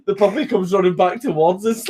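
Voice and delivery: usual speaking voice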